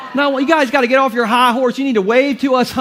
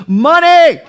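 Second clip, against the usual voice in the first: money, popped out high and loud; a sudden delighted lift on the last word; excited burst; 8 semitones higher, 8 dB louder than usual. Real, not acted. Pastor thrilled at finding pocket cash, high excited burst.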